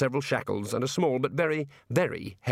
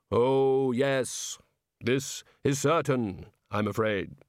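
hollow, measured voice of Death; 3 semitones higher, much slower than usual. A narrator's reading. Death greeting Rincewind in his cell, calmly. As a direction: speak as Death, calm and final.

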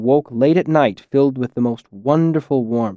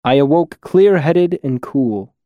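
ordinary delivery, nothing marked

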